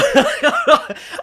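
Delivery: high, loud burst of laughter; real laugh breaking out. Real, not acted. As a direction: laugh hard at himself. Laughs at his own story.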